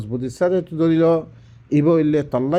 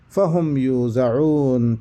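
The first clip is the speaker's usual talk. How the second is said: Quran recitation; 3 semitones lower, much slower than usual. Acted.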